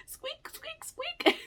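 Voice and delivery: high squeaky bat noise; laughing high bat squeak. Retold or imitated.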